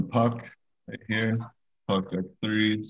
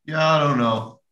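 doubtful; 6 semitones higher, 5 dB louder than usual. Retold or imitated. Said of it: "that I was like".

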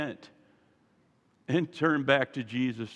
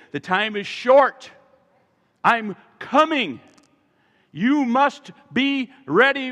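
forceful preaching; 4 semitones higher, 6 dB louder than usual. Real, not acted. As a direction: preach an urgent warning, hitting each phrase hard.

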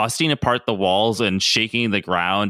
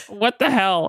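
Pietro caught off guard; 10 semitones higher, slower than usual. Retold or imitated. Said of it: "Pietro's like".

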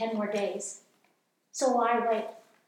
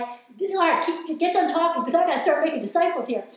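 louder, higher eager burst; eager listener; 5 semitones higher, 6 dB louder than usual. Pretend. Imagined listener urging the speaker to finish, excited and loud. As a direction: hurry me up, I'm ready to go. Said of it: "You're like".

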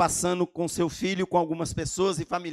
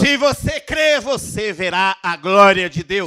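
shouted climax; 2 semitones higher, 10 dB louder, slower than usual. Real, not acted. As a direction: belt the promise out like a rally cry. Climax of sermon, repeated promise.

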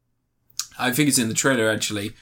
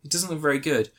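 ordinary delivery

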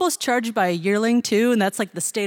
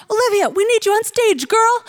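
much higher, louder "Olivia" through a door; urgent calling out; 12 semitones higher, 6 dB louder than usual. Retold or imitated. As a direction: call her urgently to the stage. Instructor calling her through door.

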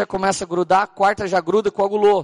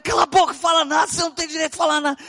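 angry shout; 8 semitones higher, faster than usual. Retold or imitated. Shutting up the robber.

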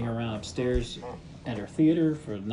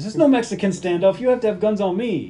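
louder, higher retort in a retold standoff; acted protest; 8 semitones higher, 7 dB louder than usual. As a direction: argue back loudly, pitched up. Acting out a standoff argument.

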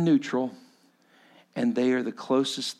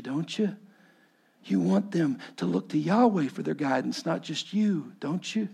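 gentle fatherly counsel; 4 semitones higher than usual. Acted. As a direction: ease him toward the truth. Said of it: "And Jethro goes".

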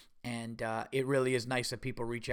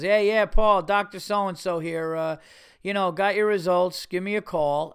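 louder, higher imitation of a doctor on voicemail; doctor's voicemail; 5 semitones higher, 7 dB louder than usual. Doctor phoning a patient about his results, imitated voicemail. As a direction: leave a voicemail as the doctor, pitched up and loud.

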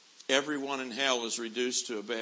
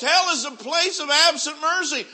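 Hell is a place of absent mercy shouted high and loud; preacher hammering home the doom of hell; fiery preaching; 14 dB louder than usual. Acted. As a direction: thunder the refrain from the pulpit. Repeated refrain, shouted.

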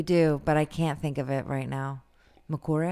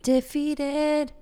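playful echo; 9 semitones higher than usual. Pretend. Echoes word playfully.

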